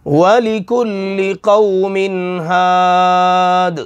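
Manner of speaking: very slow chanted verse; slow Quranic recitation. Shown as a demonstration. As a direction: draw each word out in recitation. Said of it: "हा की मश्क".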